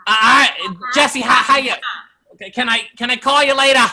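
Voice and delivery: high shouted Boston impression, R's dropped; loud Boston uncle. Imitating uncle calling Jesse, loud Boston shout. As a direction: holler it like a loud Boston relative. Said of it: "my uncle's really loud too".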